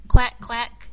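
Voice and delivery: loud duck quack. Acted. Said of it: "said Duck".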